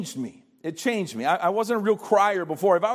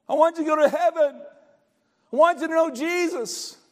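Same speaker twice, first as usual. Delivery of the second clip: much higher, heartfelt plea; a grandchild begging Papa to know Jesus; pleading; 8 semitones higher than usual. Pretend. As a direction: beg Papa with everything you have.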